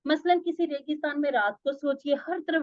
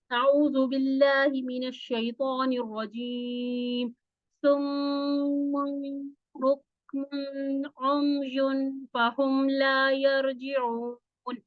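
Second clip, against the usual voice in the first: slow, drawn-out Quranic chant; Quran recitation; much slower than usual. Acted. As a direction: recite each word slowly, melodic. Teacher recites Quranic verse.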